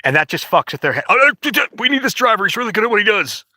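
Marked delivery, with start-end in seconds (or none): 1.1-3.4 high, hurried mock panic; stammer, then gabble in a high mock fluster; flustered bosses. Bosses realizing they need this driver, mock panicked backpedaling.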